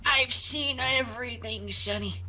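put-on very old man. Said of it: "I can't do an old man voice".

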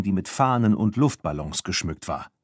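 ordinary delivery

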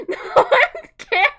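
high, loud squeal through laughter; half laugh, half mock fear; real giggling shriek. Real, not acted.

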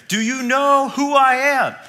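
a hammed-up yell of wounded importance; mock celebrity yell. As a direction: play the offended star shouting at the cashier. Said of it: "he yells at the cashier".

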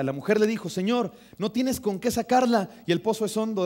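loud, emphatic reading from the pulpit; brisk scripture reading. Real, not acted. Reading Jesús and the Samaritan woman to church, loud and brisk. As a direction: proclaim the Gospel story with force and momentum. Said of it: "Lo voy a leer rapidito".

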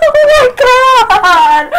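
high scream into a long, loud wail; raging. Real, not acted. Player raging at the game, screamed wail. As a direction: scream in gamer rage, drawing words out. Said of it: "understand why I'm raging".

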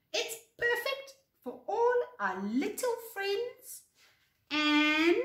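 high-pitched, delighted storybook voice; cheerful high character voice; happy agreement. Acted. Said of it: "Floppy agreed happily".

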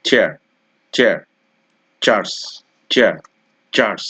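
চেয়ার and চার্চ with a crisp ch; CH sound demo. Shown as a demonstration. Teacher drilling students on the ch sound, crisp and deliberate. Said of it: "এরপরে আছে".

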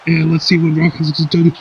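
ordinary talk

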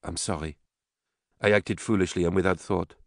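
soft, contrite apology; quiet. A narrator's reading. Mother apologizes to the daughter she slapped, quiet. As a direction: apologize gently, barely raising the voice. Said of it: "Nicole said quietly".

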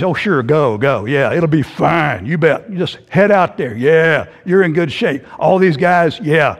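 low, dismissive mock agreement; eye-rolling sarcasm. Cantankerous prophet humouring the king, sarcastic. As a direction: roll your eyes through every word, humouring him. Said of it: "he is just rolling his eyes and".

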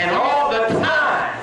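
high preacher's call to the church; call-and-response lead. Real, not acted. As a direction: call it out high, inviting an echo. Leads congregation in call and response.

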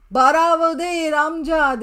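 a touch of a teasing scold; quoted retort. Retold or imitated. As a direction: playfully correct the uncle. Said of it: "Şey dedi".

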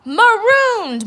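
very high, loud crew wail; moaned. A narrator's reading. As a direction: wail the echo in despair. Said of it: "moaned the others".

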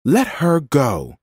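high, slow, firm command; woman shouting. A narrator's reading. Woman demanding a man release a child, shouting. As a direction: order him firmly to release the child. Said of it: "shouted the woman".

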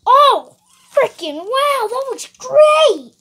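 high, loud, drawn-out wow of delight; loud amazement. Acted. Seeing the painting of himself, loudly amazed. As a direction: exclaim it big and high, stretching the key words. Sees his painted portrait.

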